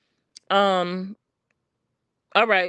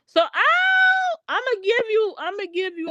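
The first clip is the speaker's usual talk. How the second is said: sudden shout; 11 semitones higher than usual. Real, not acted. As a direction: burst out with the concession at full voice. Sudden outburst while conceding.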